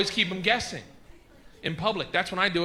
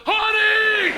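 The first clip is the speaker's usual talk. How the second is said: loud, high holler, held long; screamed out loud; 8 dB louder than usual. Retold or imitated. Husband screaming to wife in the produce section, real loud. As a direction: yell it across the whole store. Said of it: "I scream out li-, real loud".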